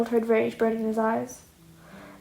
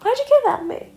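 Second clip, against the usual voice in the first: high, loud startled question; startled demand; 14 semitones higher, 5 dB louder than usual. Acted. Midoriya demands answers from Shigaraki, high and loud. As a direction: fire it off in surprised disbelief.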